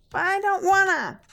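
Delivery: put-on high, loud, drawn-out kid's whine; whiny child. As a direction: refuse like a sulky little boy. Child refuses to dress.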